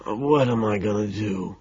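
soft, raspy despair; raspy gasp. A narrator's reading. Homeless man despairs to a stranger, in a raspy gasp. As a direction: gasp it out hoarsely and softly. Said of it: "he gasps, his voice raspy".

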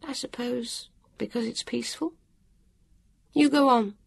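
a touch of bitterness; dour weary woman. A narrator's reading. Woman sitting down, resigned about the watcher.

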